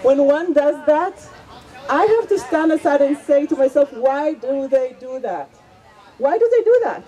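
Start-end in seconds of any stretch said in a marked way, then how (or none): none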